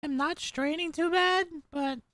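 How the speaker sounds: put-on squeaky, overexcited little kid; annoying anime kid voice. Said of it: "This voice the whole time?".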